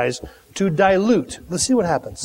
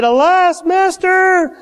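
a high, hammed-up wail of alas; mock lament; 11 semitones higher, 4 dB louder, slower than usual. Woodcutter wailing to Elisha over the lost axe head, hammed-up lament. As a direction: wail to your master, half mocking. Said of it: "And he said".

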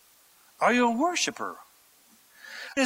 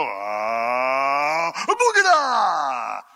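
mock healer incantation; 5 semitones lower, much slower than usual. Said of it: "wave your arm and shake and go".

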